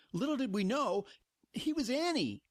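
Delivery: high-pitched incredulous reveal; mock-dramatic reveal. Real, not acted. Exasperated fan reveals Vader's silly nickname, incredulous.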